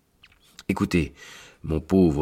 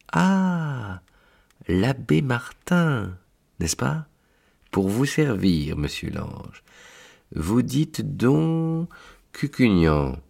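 angel; 7 semitones higher, slower than usual. Acted. Angel figure voiced.

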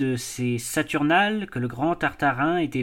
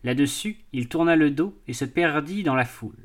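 ordinary delivery, nothing marked

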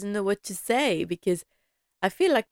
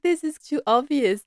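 amused mock Rose, much higher; giggling through a mock quote; laughing; 7 semitones higher, 4 dB louder than usual.

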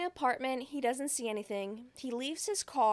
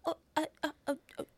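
higher-pitched, halting stammer; stuttering; 5 semitones higher, much faster than usual. Retold or imitated. Caller trying to talk to Anthony, stuttering. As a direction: act out a flustered stutter. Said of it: "he starts stuttering".

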